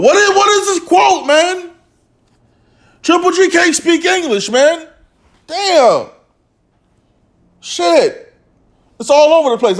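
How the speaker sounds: baffled by the quote, pitch way up; incredulous ranting. Real, not acted. Mocking the garbled quote.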